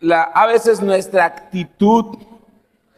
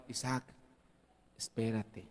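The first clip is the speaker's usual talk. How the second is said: much softer, a discreet hush at the table; hushed warning; 10 semitones lower, 23 dB quieter than usual. Retold or imitated. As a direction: quietly stop him from grabbing fruit. Me dice que está al lado. (advertencia en voz muy baja)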